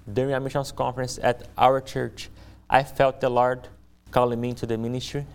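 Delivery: ordinary delivery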